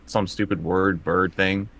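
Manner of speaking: usual speaking voice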